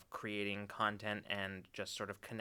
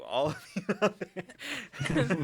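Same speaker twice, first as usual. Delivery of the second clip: booming vintage announcer voice; slow, loud old-time radio host; 9 semitones higher, 7 dB louder, much slower than usual. Acted. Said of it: "nineteen twenties radio announcer voice".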